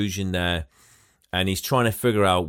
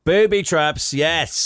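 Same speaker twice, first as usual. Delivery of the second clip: high, loud gleeful cry; excited cheer; 7 semitones higher, 5 dB louder, slower than usual. Real, not acted. Thrilled about the coming booby traps, a high loud cheer.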